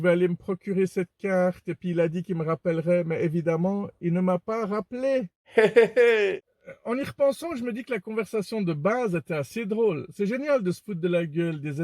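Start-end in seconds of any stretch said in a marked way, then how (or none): 5.6-6.4 short, louder chuckle; quick burst of laughter. Real, not acted. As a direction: let out a brief amused laugh. Laughs after telling the failed scam.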